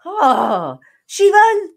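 loud, drawn-out exasperated cry; loud stressed-out moan about family; exasperated wail. Real, not acted. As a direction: wail it like family is driving you crazy. Stressed about Haitian family visit.

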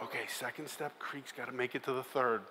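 very soft, whispered; sneaking up creaky stairs, whispering to himself; whispered plan. Retold or imitated. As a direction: whisper each step as you sneak up.

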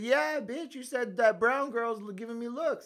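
high, loud snapping comeback; retold angry retort. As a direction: throw it back at him, high and loud. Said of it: "I said".

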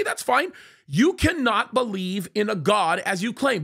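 ordinary delivery

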